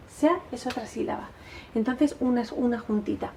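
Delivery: usual speaking voice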